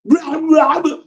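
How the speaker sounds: a sneering squeaky imitation; mocking her ending. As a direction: parody her sentence endings. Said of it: "at the end".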